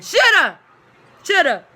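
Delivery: loud, high sarcastic shoulda; indignant retort. Real, not acted. Retorting to the lady's advice, loud and high. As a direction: fire back shoulda twice. Snapping back at the lady.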